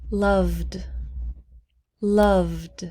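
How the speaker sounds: "loved" as one syllable, no E sound; silent E. Shown as a demonstration. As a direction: say "loved" with V running straight into D. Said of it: "We do not pronounce the E".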